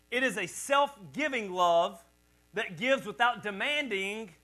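loud, slow and deliberate; slow preaching build. Acted. Pastor reading agape notes to the church, slow preaching. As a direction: preach it, rising with the music.

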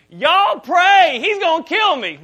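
high, loud panicked scream with a Kentucky twang; screamed Kentucky accent. Retold or imitated. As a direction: scream for help like a Kentucky kid being hauled off. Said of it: "he just screamed out in his best Kentucky accent".

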